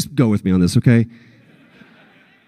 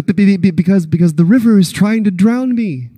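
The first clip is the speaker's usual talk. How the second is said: a higher, stammering, panicky little voice; Piglet impression; 7 semitones higher than usual. Piglet telling Pooh the river is drowning him, stammering in panic. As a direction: stutter out the fear like a tiny piglet. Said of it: "horrible impressions".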